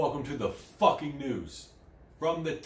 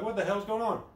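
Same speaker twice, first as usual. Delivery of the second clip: mock confused bystander; 10 semitones higher than usual. Imitating bystanders.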